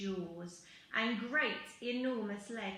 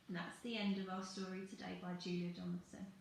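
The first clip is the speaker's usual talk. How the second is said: ordinary delivery, nothing marked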